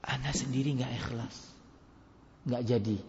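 soft, inward self-doubt of a hesitant preacher; self-doubting aside. Retold or imitated. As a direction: murmur it to yourself, backing out. Voicing hesitant ustadz's inner thoughts. (gumaman ragu pada diri sendiri)

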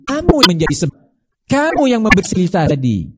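a preacher thundering at careless parents; fiery preaching. Acted. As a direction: scold the congregation from the pulpit.

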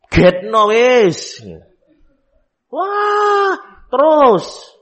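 high wailing child cry, acted; imitated child crying. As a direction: wail like a child who won't stop crying.

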